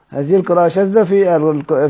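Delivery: ordinary delivery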